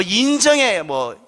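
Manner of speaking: normal talking voice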